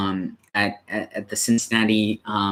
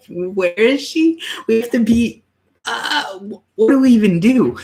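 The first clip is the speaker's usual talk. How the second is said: much higher, louder, slowed frantic questions; retelling a frantic scramble to reach Texas; panicked disbelief; 13 semitones higher, 5 dB louder than usual. Retold or imitated. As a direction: relive the rush of panic, pitched way up. Retold panic over sudden baby.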